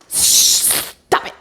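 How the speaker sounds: long sharp shush, then low command; firm hushing. Retold or imitated. Anne silencing her panicking relatives, firm hush.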